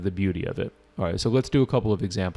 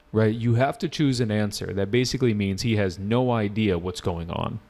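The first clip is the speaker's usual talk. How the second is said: ordinary delivery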